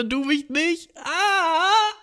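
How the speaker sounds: high, whimpering fake sob; hammed-up crying plea; mock crying whine. Coworker begs his girlfriend on the phone, mock sobbing whine.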